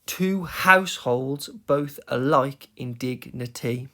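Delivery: "Two households" slow and measured, beat by beat; metrical stress. Shown as a demonstration. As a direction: scan "both alike in dignity" slowly, weak then strong. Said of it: "let's have a look at an example".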